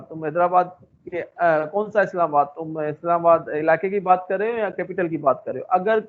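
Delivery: ordinary talk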